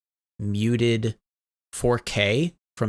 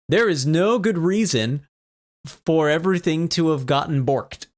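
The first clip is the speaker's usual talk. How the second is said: ordinary delivery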